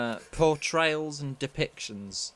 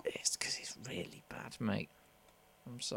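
whisper; 12 dB quieter than usual. Real, not acted.

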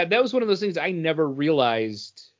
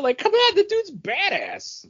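excited hype. Real, not acted. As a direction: rave about how cool he is. Hyping favorite character.

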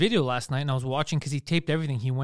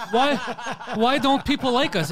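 high, loud mock plea; exaggerated, high-pitched wounded complaint; mock bewilderment; 8 semitones higher, 4 dB louder than usual. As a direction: imitate clueless people pleading to be liked. Mocking the broadcasters' complaint.

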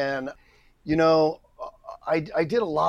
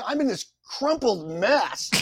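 ordinary talk

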